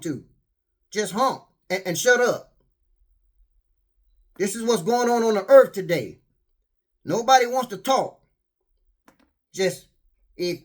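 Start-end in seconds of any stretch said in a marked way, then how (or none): none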